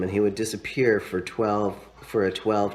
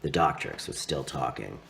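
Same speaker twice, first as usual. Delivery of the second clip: usual speaking voice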